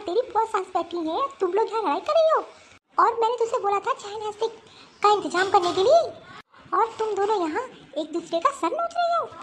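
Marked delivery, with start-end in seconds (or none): 1.4-9.3 mother-in-law scolding the brawling wives; angry scolding. Acted. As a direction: tell them off sharply.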